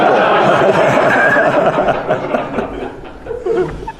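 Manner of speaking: high burst of laughter; big laugh. Real, not acted. Laughs at the rant.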